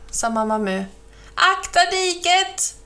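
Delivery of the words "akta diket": high, drawn-out alarm call; urgent crow yell, slowed and pitched up; shouted warning. A narrator's reading. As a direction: cry out to warn the rider. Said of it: "ropade Kråkan".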